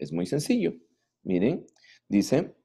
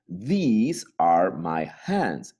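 slow model sentence; much slower than usual. Shown as a demonstration. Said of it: "slow model sentence".

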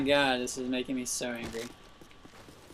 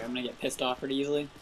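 ordinary talk, nothing marked

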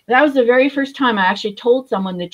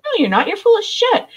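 blunt counselor; 13 semitones higher than usual. Retold or imitated. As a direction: play the counselor who won't buy the act, pitched up. Counselor confronting her in rehab.

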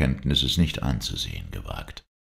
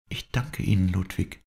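put-on higher young woman, grateful; higher grateful girl's voice; Dorette's voice; 6 semitones higher than usual. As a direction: thank him gently as the girl, higher. Dorette thanks narrator.

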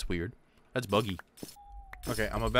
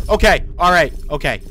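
shaken; 4 semitones higher, 10 dB louder than usual. Real, not acted. Catching breath after panic.

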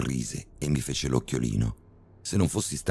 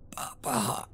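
a failed shout that comes out as a breathy moan; weak moan; 8 dB quieter than usual.

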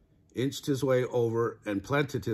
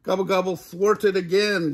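exasperated groan; 7 semitones higher, 7 dB louder than usual. A narrator's reading. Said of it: "groaned Turkey".

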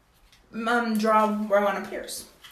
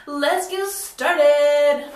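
drawn-out hype; 3 semitones higher, 7 dB louder, much slower than usual. Pretend. Hyped intro catchphrase.

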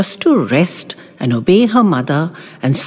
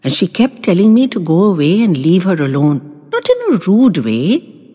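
plain reading voice

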